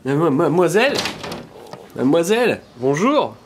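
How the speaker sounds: loud call to a passerby below. Pretend. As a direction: shout up to the street to be heard. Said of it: "Dès que je parle plus fort".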